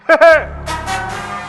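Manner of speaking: big theatrical evil laugh; long gloating villain laugh; villainous laugh. Acted. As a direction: laugh mockingly like a stage demon.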